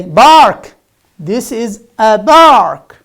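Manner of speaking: loud "bark" for park, voiced B; heavy B in "bark" where P belongs. Shown as a demonstration. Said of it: "which is not correct".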